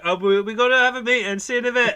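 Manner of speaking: hammed-up cartoon exit line; mock Porky. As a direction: rattle it off like a cartoon pig. Porky voiced leaving the show.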